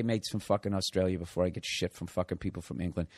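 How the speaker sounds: ordinary delivery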